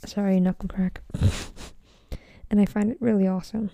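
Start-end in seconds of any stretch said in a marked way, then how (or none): none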